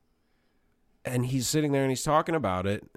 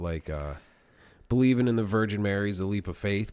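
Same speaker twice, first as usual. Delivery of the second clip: ordinary talk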